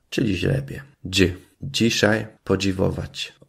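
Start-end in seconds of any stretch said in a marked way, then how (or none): none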